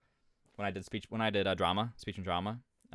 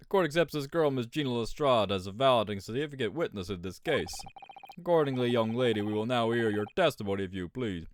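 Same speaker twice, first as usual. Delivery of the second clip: loud opening, falling at phrase ends; game judge; 5 dB louder than usual. Acted. Judge calling a young witness to testify, formal pronouncement.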